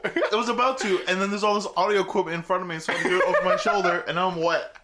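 real laughter breaking into the words; laughing explanation. Real, not acted. Explaining a botched drink to co-host, fast laughing voice.

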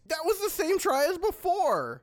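high, frantic complaint; exasperated yell. Acted. Fighter to wizard after failed spell, exasperated. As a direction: shout it, fed up.

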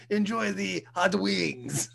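slow French waiter flourish; drawn-out comic French accent. Acted. French waiter serving hot wings, slow French accent. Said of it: "That's my French accent".

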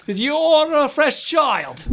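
high, slow laughing shout; shouting innkeeper. Acted. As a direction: yell it up the stairs, cracking up. Innkeeper yelling joke.

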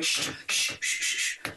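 whispered shh shh to hush people; hushing onlookers in the dark cinema; unvoiced shushing, again and again. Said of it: "and I went".

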